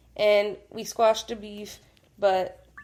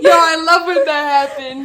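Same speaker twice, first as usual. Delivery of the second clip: loud, high, through laughter; laughing; 7 semitones higher, 13 dB louder than usual. Real, not acted. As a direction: crack up while saying it. Both cracking up at a memory.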